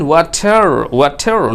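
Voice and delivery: "water" with a clear t and a sounded final r; r sounded after t. Shown as a demonstration. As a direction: say "water" as "wa-ter", keeping both t and r. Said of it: "no problem, সমস্যা নাই". ("water"-এ ট আর শেষের র দুটোই স্পষ্ট)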